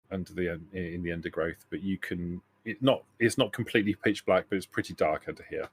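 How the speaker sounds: ordinary talk, nothing marked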